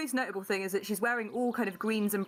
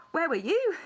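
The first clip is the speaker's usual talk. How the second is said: cheeky retort; 8 semitones higher, 5 dB louder than usual. Acted. Yarrow throwing question back.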